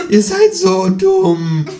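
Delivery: very high, slow, drawn-out scoff with a laugh; kangaroo scoffs. A narrator's reading. Kangaroo mocks the voters, very high and slow.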